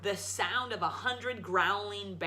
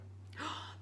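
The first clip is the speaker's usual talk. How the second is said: surprised gasp; 10 dB quieter than usual. Acted. Mother sees the gold.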